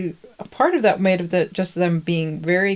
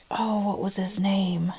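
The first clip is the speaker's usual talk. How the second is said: whispered aside; 5 dB quieter than usual. Real, not acted. Searching memory.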